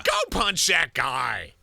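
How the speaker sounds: slow, loud mock command; hammed-up tough-guy holler; bully giving orders. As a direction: drag it out like a cartoon bully. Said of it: "That's how they talk".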